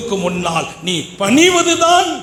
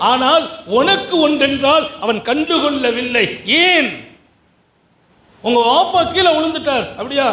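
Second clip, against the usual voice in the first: high, impassioned sermon voice; fiery preaching; 8 semitones higher than usual. Acted. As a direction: preach it to the congregation.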